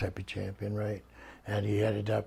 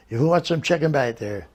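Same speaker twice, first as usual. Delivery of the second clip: raised, louder call to passengers; 4 semitones higher, 6 dB louder than usual. Retold or imitated. Wrestling boss offers chicken to the back seat, calling out. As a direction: call back over your shoulder.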